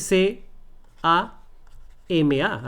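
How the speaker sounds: "C A M A" as letter names, ce, a, eme, a; spelled letter by letter. Shown as a demonstration. Teacher showing what you don't say, spelling "C A M A". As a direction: spell out "C A M A", pausing between letters. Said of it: "Tú no dices".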